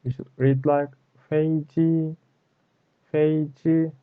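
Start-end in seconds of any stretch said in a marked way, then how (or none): 1.3-3.9 "Fei Ji" held high and constant on both syllables; double first tone. Shown as a demonstration. Teacher models two first tones, high and constant. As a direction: keep "Fei Ji" level, high up. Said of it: "Our sound is constant".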